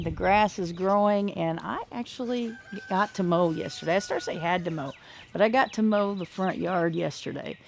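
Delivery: usual speaking voice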